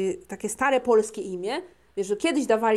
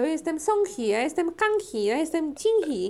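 higher-pitched, singling out each cousin; mock cousins' introductions; 5 semitones higher than usual. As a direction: mimic cousins introducing themselves, amused. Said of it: "kuzynki się spotykają". (wyższym głosem przedrzeźniane przedstawianie się kuzynek)

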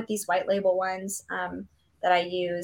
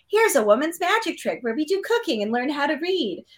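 put-on old-timey woman voice; 6 semitones higher than usual. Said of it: "that's my old-timey woman voice".

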